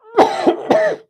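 a loud, sudden real sneeze; sneezing. Real, not acted. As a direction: sneeze sharply, high and loud. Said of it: "Excuse me, I'm gonna sneeze".

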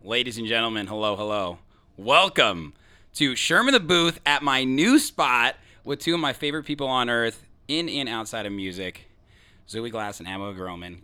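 loud, projected host welcome; show-opening announcer. Acted. Host welcoming listeners to his new spot, announcer voice. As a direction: announce it big, pushing pitch and volume up.

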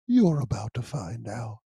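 ordinary delivery

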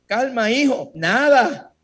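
mock gentle priest voice, drawn out; mock comforting priest. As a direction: imitate a fatherly priest calming his son. Imagined priest comforting, mocked.

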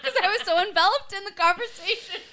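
laughing. Real, not acted. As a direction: laugh through the excuse. Caught eating, laughing.

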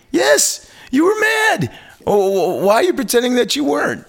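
hammed-up indignation; indignant outburst. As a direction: squeak with disbelief at the denial. Retorting that the singer was mad.

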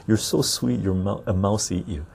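usual speaking voice

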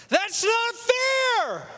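high, slow drawn-out cry of protest; mock yell at the professor's injustice. Student in class yelling at the professor, high drawn-out protest. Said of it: "yells out".

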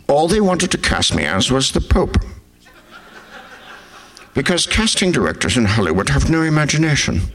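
layered, badly faked actor impression; Gregory Peck doing Max von Sydow. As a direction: play one film star mimicking another, swooping in pitch. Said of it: "this is Gregory Peck doing Max von Sydow".

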